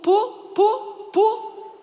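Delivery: put-on high, excited little-kid chant of the word; toddler voice. Dad imitating his son begging to swim, high and eager. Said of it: "and then he goes".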